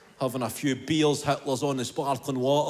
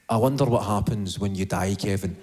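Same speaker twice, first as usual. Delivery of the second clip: low, ominous inner voice; lightly voiced creeping inner voice, low; 10 semitones lower than usual. The brain's wee voice needling Kevin at night, low and creeping. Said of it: "that wee voice comes in".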